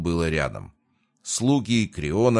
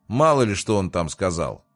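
ordinary delivery

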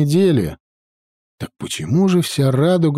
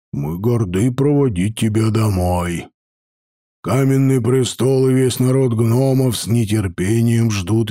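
низкий, медленный, важный голос воина; вещай как гордый гном-эрл; торжественный гном-воин; 3 semitones lower than usual. Acted. Эрл Китер торжественно говорит. (торжественный гном-воин)

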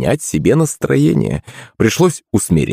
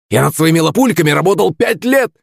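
put-on high, aggrieved old man's rant; continued shouting; 9 semitones higher than usual. A narrator's reading. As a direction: rant high-pitched, peaking on the last word. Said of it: "продолжал кричать старик".

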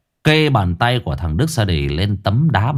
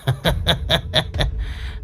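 breathy thug's sneering laugh; menacing laugh; 5 semitones higher, 6 dB quieter than usual. A narrator's reading. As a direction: laugh at the victim.

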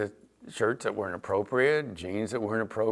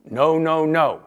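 louder, insistent no no no; firm parent no; 4 semitones higher, 6 dB louder, slower than usual. Pretend. Father at the stairs sending his daughter back up, firm and louder. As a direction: plant your feet and refuse.

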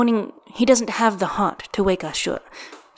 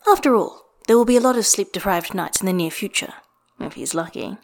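ordinary delivery

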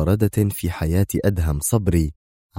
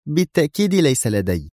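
higher-pitched, nervy retort; edgy denial; 7 semitones higher than usual. A narrator's reading. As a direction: deny it with irritation. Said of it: "وبدت أكثر عصبية وهي تقول". (بعصبية)

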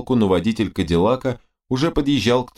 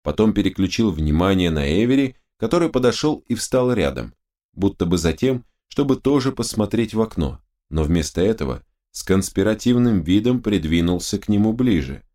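ordinary delivery, nothing marked

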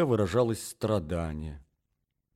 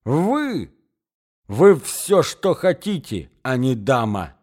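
indignant cry; 3 semitones higher, 6 dB louder, slower than usual. Acted. Angry young man tells the woman she is no lady, crying out. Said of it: "вскричал Мозгляков".

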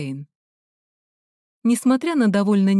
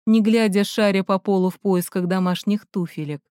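ordinary delivery

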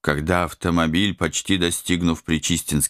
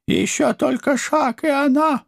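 high, threatening creature voice; high menacing voice. Acted. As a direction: hiss a threat over the hostage. Villain threatens hostage.

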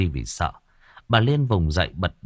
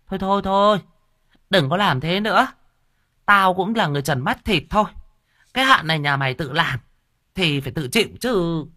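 scoffing medium; 7 semitones higher than usual. Acted. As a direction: count it off on your fingers, grandly. Medium lectures wife.

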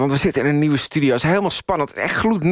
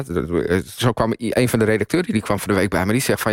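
ordinary talk

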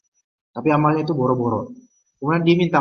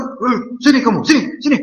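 high, loud mock call to come here; angry calling; 8 semitones higher, 4 dB louder than usual. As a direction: bark him over to get a beating. Angry man calling someone over.

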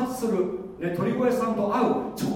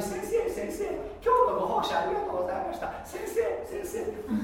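high-pitched fawning praise; fawning flatterer; 14 semitones higher, 4 dB quieter than usual. Retold or imitated. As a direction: gush over the teacher, pitched way up. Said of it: "とか言ってね".

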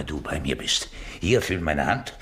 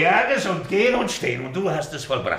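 high, loud and triumphant; exultant; 10 semitones higher, 5 dB louder, faster than usual. Acted. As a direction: shout it like you can dance again. Healed man exulting.

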